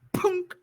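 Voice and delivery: sudden loud, high "punk!"; imitated crowbar pop. Shown as a demonstration. Game master shows players the crowbar popping the chain, sudden loud sound effect.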